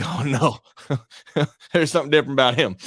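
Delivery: mock reaction in a light Southern accent. Host imagining hearing a commentator talk, Southern accent. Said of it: "went Southern on that one".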